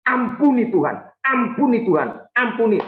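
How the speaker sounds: high, urgent plea to God; pleading prayer. Acted. Repentant believer prays to God for forgiveness, pleading.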